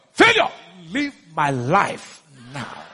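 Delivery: slow, low banishment; measured command. Shown as a demonstration. Preacher showing how to address failure, slow low command. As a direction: spell out the order slowly and low.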